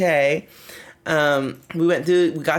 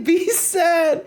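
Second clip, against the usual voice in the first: laughing mock yell. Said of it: "I kept yelling".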